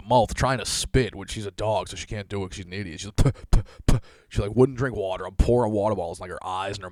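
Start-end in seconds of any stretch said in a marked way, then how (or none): 3.2-4.0 imitated dog spitting sounds, puffed and louder. Dog spits after getting skunked, imitated puffing. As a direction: mimic a dog trying to spit.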